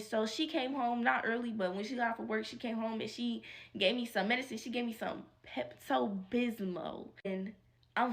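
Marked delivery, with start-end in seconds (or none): none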